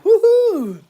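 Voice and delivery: high, loud woo-hoo; excited whoop. Real, not acted. Streamer cheering at a viewer's joke, excited whoop. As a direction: whoop it out with glee.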